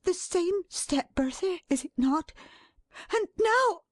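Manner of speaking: high, urgent anticipation; panted, mounting excitement. A narrator's reading. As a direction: count down each sound as it nears. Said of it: "she panted".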